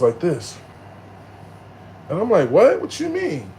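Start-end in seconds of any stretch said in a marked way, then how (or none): 2.5-3.5 high-pitched, startled questioning; retold disbelief. Stabbed man questioning a bystander, incredulous. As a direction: act out a baffled double-take, pitch leaping.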